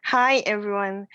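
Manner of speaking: usual speaking voice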